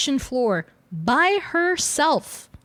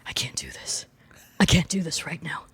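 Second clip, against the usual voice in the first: hushed, breathy whisper as if cornered; 6 semitones lower, 4 dB quieter than usual. Retold or imitated. Scott, unable to face the new baby, whispering. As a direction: whisper it to yourself, overwhelmed. Scott voiced, overwhelmed at son's birth.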